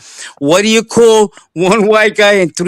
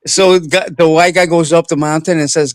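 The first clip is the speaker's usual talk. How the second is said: ordinary talk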